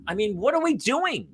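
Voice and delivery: ordinary talk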